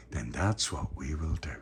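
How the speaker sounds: slow soft whisper. Real, not acted. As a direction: bedtime whisper to little ones. Bedtime whisper to child.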